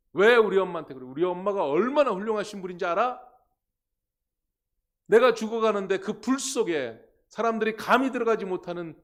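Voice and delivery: loud, high, impassioned defence of mom; proud boasting. Acted. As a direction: stand up to the teasers as a proud son. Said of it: "그러면서 그 엄마를 자랑했다고". (엄마를 자랑하며 큰 소리로 맞서는 아들 연기)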